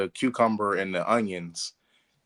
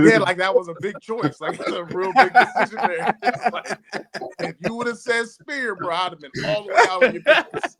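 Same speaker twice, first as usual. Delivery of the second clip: high, laughing mock outrage; laughing tease; 8 semitones higher, 4 dB louder than usual. Real, not acted. Laughing mockery of the "Mm.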